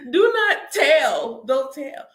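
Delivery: slow, loud, laughing command; mock-stern laughing order; laughing shout. Real, not acted. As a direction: yell it through laughter.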